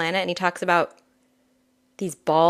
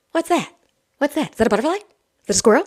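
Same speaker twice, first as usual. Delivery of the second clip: much higher, eager little goat voice; excited baby goat; 7 semitones higher, 3 dB louder, much faster than usual. Acted. Little goat spotting a butterfly, much higher excited voice. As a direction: dart from thing to thing, thrilled. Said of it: "The little goat's like".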